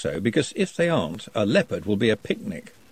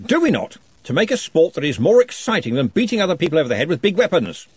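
louder, higher, hearty interrogation; booming wizard questioning; 6 semitones higher, 3 dB louder than usual. Acted. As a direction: press the shy goblin with jovial authority. Ridcully holds forth.

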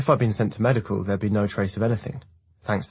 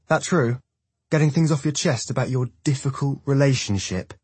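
mocking; 6 semitones higher, 3 dB louder than usual. A narrator's reading. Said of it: "Jackson mocks".

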